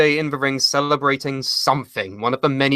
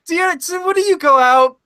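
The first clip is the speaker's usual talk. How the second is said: higher mock questioning; high-pitched mock of the hosts; mock incredulous host; 9 semitones higher than usual. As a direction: ham up the baffled interviewer. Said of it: "they's like".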